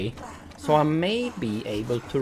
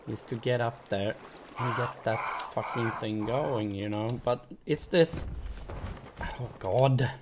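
ordinary delivery

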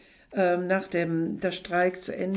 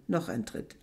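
ordinary delivery

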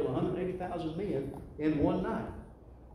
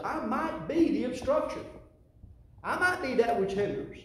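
rising emphatic preaching; 8 semitones higher, 4 dB louder than usual. Real, not acted. Preacher pressing the point on the congregation, loud and high. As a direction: pitch climbs high and loud on be.

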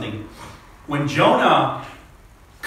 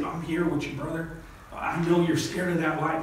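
softer, cooing mock sympathy; mock consoling; 2 semitones higher, 6 dB quieter than usual. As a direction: coo it like fake comfort. Mock comforting the scared man.

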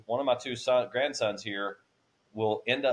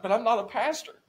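much higher, startled objection; surprised protest; 10 semitones higher, 3 dB louder than usual. Retold or imitated. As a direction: push back in disbelief. Protesting to God in retelling.

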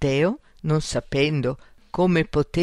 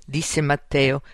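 plain reading voice

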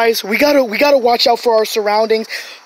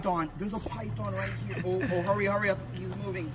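much softer, urgent hush; 5 semitones lower, 18 dB quieter than usual. Real, not acted. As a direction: keep it down, don't spook it. Sneaking up on a python.

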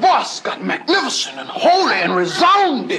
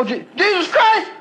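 rambling wino; 7 semitones higher than usual. Retold or imitated. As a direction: ramble like a street drunk. Wino impression.

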